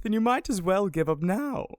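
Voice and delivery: ordinary talk, nothing marked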